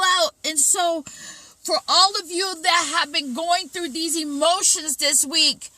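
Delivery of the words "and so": usual speaking voice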